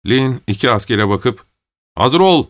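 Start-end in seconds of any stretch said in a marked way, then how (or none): 2.0-2.5 higher-pitched military call to order; raised voice, rising high; shouted command. A narrator's reading. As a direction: call the soldiers to attention. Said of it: "diye haykırdı".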